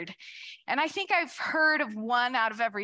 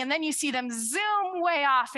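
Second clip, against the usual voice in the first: usual speaking voice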